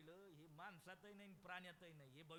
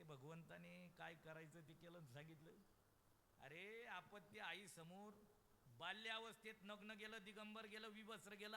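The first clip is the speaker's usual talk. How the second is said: normal talking voice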